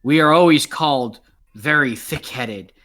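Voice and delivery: loud, proud goblin declaring; lightly voiced boastful goblin. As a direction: boom it out like a thick-headed goblin. GM voicing goblins.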